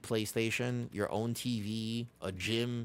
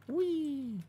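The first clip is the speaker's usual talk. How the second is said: a sudden squeal of delight, much higher; gleeful whee. Real, not acted. Streamer reacting to the game with a high gleeful whee. Gleeful cry during the run.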